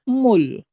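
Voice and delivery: "mul" as one drawn syllable closing on l; teacher's slow model "mul", tongue resting on the l; final L demo. Shown as a demonstration.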